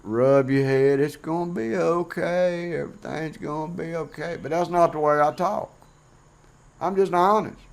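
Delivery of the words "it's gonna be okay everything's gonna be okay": sarcastic imitation of a gentle comforter; mock gentle comfort. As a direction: coo it like you're patting a head, half mocking. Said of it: "real kind and gentle and rub your head".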